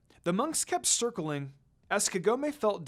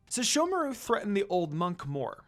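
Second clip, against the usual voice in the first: usual speaking voice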